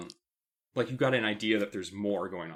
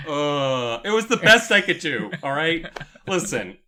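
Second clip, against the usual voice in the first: loud; 5 semitones higher, 10 dB louder than usual. Real, not acted. Laughing it off.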